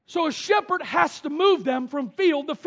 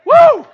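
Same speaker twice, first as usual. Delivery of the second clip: high loud whoop; excited whoop; 13 semitones higher, 16 dB louder than usual. Real, not acted. Preacher whooping to the church, joyful whoop. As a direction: let out a joyful woo.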